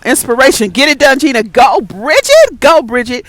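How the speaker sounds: loud cheer for a friend; rally them, loud and proud. Real, not acted. Cheering on a viewer.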